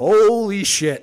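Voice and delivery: loud, high, slow mock shock; hammed-up amazed outburst; mock shocked exclamation. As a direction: drag out a stunned holy shit. Mocked reaction quote.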